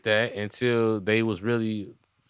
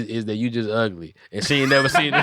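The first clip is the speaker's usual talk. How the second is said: usual speaking voice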